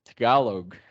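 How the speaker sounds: TAGALOG in an American accent, first vowel reduced. Shown as a demonstration. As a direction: say Tagalog like an American English speaker. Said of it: "say that in American accent".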